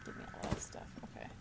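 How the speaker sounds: whisper. Real, not acted. Whispered aside while looting.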